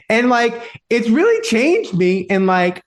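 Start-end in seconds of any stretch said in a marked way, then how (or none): none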